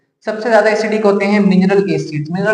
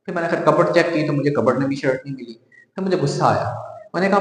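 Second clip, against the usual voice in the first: ordinary talk, nothing marked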